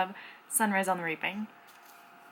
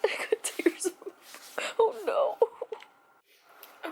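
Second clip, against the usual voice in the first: high, breathy teary laugh; laugh-cry the words out; crying, worn out; 11 semitones higher, much slower than usual. Real, not acted. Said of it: "I can't stop crying".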